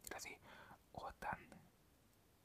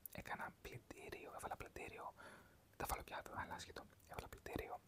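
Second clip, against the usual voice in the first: whispered, unvoiced ASMR talk; 7 semitones lower than usual. Welcoming viewers to another ASMR video, whispering.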